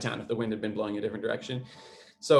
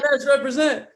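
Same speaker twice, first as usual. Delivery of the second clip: excited shout-out; 7 dB louder than usual. Real, not acted. Cheering for his hometown.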